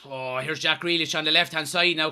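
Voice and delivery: ordinary talk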